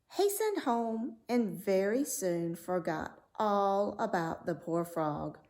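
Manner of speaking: usual speaking voice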